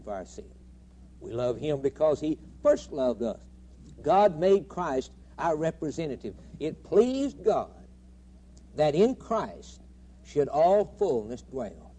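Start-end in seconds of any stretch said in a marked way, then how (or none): none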